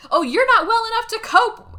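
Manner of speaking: loud, high retold outburst; angry outburst. Husband about to storm out on his wife, acted angry outburst. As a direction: imitate a spouse boiling over. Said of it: "and then storms out".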